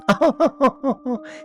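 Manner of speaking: light high laugh; high dismissive laugh. A narrator's reading. Maureen laughing off David's story, dismissive laugh. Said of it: "Maureen just laughed".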